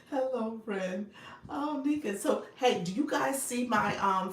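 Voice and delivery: ordinary talk, nothing marked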